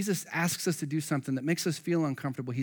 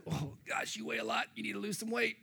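much softer, higher joking complaint; mock grumble; 6 semitones higher, 9 dB quieter than usual. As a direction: tease as if straining to lift him. Joking mock Jesus line.

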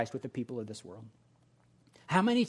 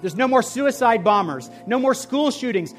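loud, higher, fervent sermon climax; preaching at full force; 7 semitones higher, 9 dB louder than usual. Acted. Pastor thundering the list of heaven's absences, full-force preaching. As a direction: shout each no more like a rising wave.

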